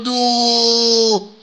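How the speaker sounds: high, loud "no"; despairing shout. Real, not acted. Bad pull result.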